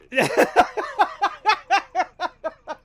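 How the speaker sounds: high loud laugh; laughing at the self-arrest joke; long laugh. Real, not acted. Laughing after kill me joke.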